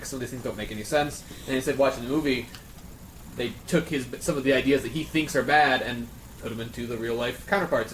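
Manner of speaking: usual speaking voice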